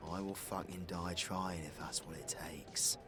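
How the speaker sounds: low, hushed determination; grim vow. Acted. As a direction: swear it under your breath, low and soft. Grim vow to fight the monster.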